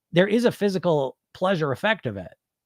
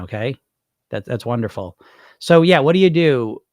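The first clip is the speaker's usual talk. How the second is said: normal talking voice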